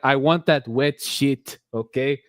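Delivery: hot-blooded Latin accent, played up. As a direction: ham it up as an impassioned Latin lover. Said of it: "read this for me in your Latin voice".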